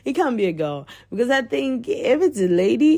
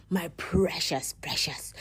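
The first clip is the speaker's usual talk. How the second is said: soft whispered my precious; hushed Smiggle impression; 5 semitones lower, 8 dB quieter than usual. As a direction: whisper it like a creature coveting a ring. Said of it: "I can get this voice".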